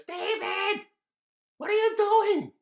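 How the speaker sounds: high, loud exasperated mom shout; shrieking mom voice; mother yelling. Acted. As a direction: catch him red-handed. Mother catches David.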